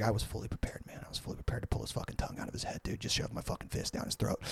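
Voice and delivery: fast, hushed, half-whispered imitation; under his breath. Frankie breaking down what he was prepared to do, muttering under his breath. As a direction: rattle off violent threats very softly, as if to yourself. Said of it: "I hear him under his breath".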